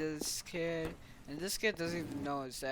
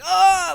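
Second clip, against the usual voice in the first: very loud high pained cry; 22 dB louder than usual. Retold or imitated. Kid hit by the punch, very loud high yelp. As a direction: yelp as the blow lands.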